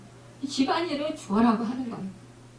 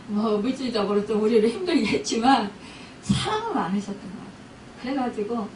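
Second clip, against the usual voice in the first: usual speaking voice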